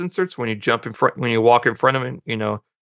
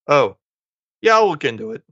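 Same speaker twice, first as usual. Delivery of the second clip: snarky; 4 semitones higher, 3 dB louder than usual. Retold or imitated. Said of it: "she was kinda snarky".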